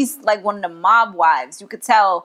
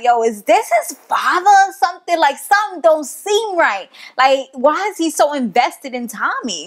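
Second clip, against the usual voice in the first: high-pitched, incredulous questioning; suspicious disbelief; 6 semitones higher than usual. Retold or imitated. Viewer wonders why he's so invested in Tommy, incredulous. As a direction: puzzle it out aloud, pitch raised. Her suspicious reaction while watching.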